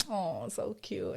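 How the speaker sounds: soft, slow coo; retold cooing. Woman seeing older couple on motorcycles, soft cooing. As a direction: melt quietly over something adorable.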